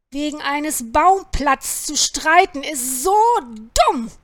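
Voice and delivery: loud, slow, exasperated scolding; grousing farmer's rebuke; grumbling complaint. A narrator's reading. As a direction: stretch out the disapproval, emphatically. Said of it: "meckerte Anton".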